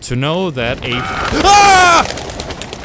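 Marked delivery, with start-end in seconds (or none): 1.4-2.8 high, loud scream of fright; startled scream. Real, not acted. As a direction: scream as something jumps out. Crow startles player mid-sentence.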